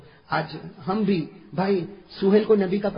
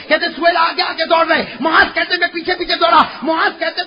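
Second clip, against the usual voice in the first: impassioned preaching; 10 semitones higher, 7 dB louder than usual. Acted. Preaching at full force.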